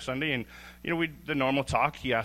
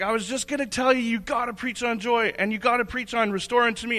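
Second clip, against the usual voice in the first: high, fast, excited gush; excited rush; 11 semitones higher than usual. Retold or imitated. Friend on the phone urging him to preach on joy, high and gushing. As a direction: blurt it out high and breathless with excitement. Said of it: "he was freaking out on the phone".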